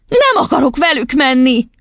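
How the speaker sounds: put-on high child's protest; high-pitched upset exclamation; sudden cry. A narrator's reading. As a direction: cry out defiantly as a little girl. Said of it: "kiáltott fel hirtelen".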